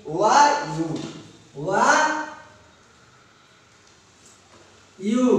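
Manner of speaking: ୱା as a W glide, ୟୁ as a Y glide; consonant-like opening sounds. Shown as a demonstration. Teacher shows vowels pronounced as consonants, sounded out separately. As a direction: sound out ୱା and ୟୁ, each standing alone. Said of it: "ବ୍ୟଞ୍ଜନର ଉଚ୍ଚାରଣ ହଉଛି".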